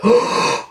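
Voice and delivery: sharp high gasp of fright; imitated gasp. Mimicking his wife's frightened gasp in the car. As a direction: gasp like the wife did. Wife gasps in rolling car.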